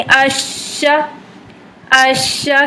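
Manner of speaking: अश्शा drawn out slowly with doubled sheen; slow sheen demo. Shown as a demonstration. Teacher demonstrating sheen's makhraj for students. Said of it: "मखरज सही लग रहा है या नहीं".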